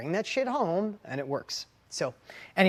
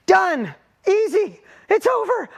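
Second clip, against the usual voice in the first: high, loud triumphant bursts; retold jubilant yell; elated relief; 13 semitones higher, 12 dB louder, slower than usual. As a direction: shout each one out in relief. Dad's elated relief at the answer.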